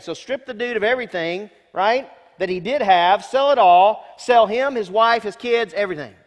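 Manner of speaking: rising to a loud pulpit shout; building preacher shout. Real, not acted. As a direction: drive it home to the congregation. Preacher drives home the point.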